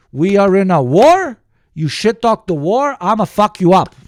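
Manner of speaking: imitating a loud, blunt official threat. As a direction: bark it like a frank strongman. Russians voiced bluntly threatening.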